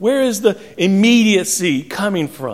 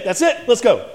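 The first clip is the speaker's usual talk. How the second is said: a mild mock snap, climbing higher on the last line; hammed-up exasperated parent, not really shouting; snapping parent; 4 semitones higher than usual. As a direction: play the fed-up parent calling time. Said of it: "they snap".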